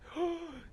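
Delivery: high theatrical gasp; mock amazed gasp. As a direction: gasp like an awestruck classroom. Imitates amazed classmates' reaction.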